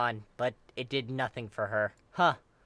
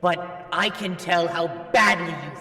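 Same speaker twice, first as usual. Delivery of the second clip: put-on a holy being dressing down a foolish child; booming scolding angel; 5 semitones higher, 6 dB louder than usual.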